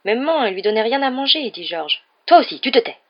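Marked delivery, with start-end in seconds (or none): 0.1-1.5 high, louder whine of protest. Acted. George defending himself to his mother, protesting. 2.3-3.0 high, loud snap; angry silencing. Acted. Mother cutting George off, angry silencing.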